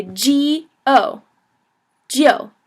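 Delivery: "G", "O" slowly, then blended into "Joe"; slow build from "G O" to "Joe"; sounded out. Shown as a demonstration. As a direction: say gee, oh, then glide them into "Joe" as jiu. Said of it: "so you say".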